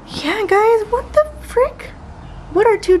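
shocked at the ducks; high disbelieving squeal; baffled disbelief. Real, not acted. Ducks suddenly in her pool.

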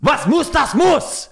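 slow, high, hammed-up German. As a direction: drag out a mock German accent. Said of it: "that was very German".

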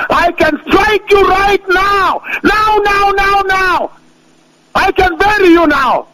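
thunderous shout. Acted. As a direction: roar it down on them.

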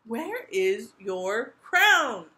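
very slow, loud, stern question; heavy, drawn-out royal interrogation. A narrator's reading. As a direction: demand it word by word, like a stern king. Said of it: "asked the king sternly".

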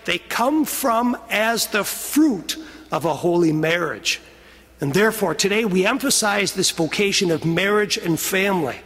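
normal talking voice

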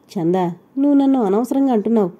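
usual speaking voice

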